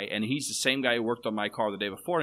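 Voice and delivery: ordinary talk, nothing marked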